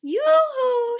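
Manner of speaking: high, loud happy call; shouting yoo-hoo. Acted. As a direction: call out across the water to friends. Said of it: "she shouted".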